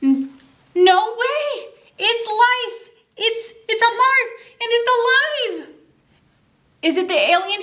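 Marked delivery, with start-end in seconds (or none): 0.0-5.8 high, breathless excited cry; thrilled astronaut. Acted. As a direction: gush with astonished joy. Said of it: "So he says".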